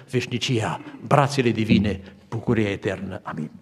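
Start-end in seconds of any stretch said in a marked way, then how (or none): none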